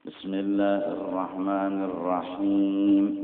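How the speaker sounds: Bismillahirrahmanirrahim drawn out slowly, each syllable recited; careful Arabic recitation. Shown as a demonstration. Preacher models the basmala for the congregation, slow and clear.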